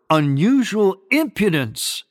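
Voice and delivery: UNUSUAL IMPUDENCE pitched high with emphasis; emphasized words. Real, not acted. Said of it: "I emphasize the word".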